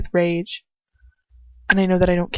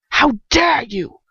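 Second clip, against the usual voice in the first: slow, higher, seething how dare you; enraged; 5 semitones higher, 3 dB louder, slower than usual. Acted. Furious captor to the girl he caught, enraged. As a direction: let the rage drag each word out. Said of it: "stares at me with such rage".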